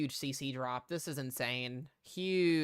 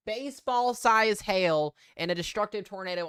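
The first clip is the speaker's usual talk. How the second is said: loud, urgent storm report; breathless shouting over wind; 5 semitones higher, 10 dB louder than usual. Real, not acted. Reporting destructive tornado live.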